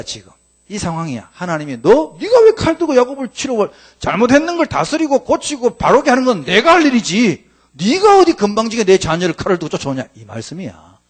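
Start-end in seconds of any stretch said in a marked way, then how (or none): 1.8-10.0 higher, louder stern rebuke; God rebuking. Acted. God warning Laban off his child, loud stern rebuke. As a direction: scold him like a protective father.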